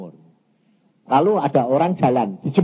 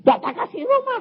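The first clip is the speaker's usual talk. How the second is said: high-pitched mock wail; mock crying. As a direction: wail it high, imitating tears. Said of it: "nangis kayak gitu". (menirukan tangisan dengan suara tinggi)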